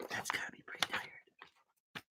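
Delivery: hushed joking aside; soft unvoiced whisper. Real, not acted. Aside to listeners during story.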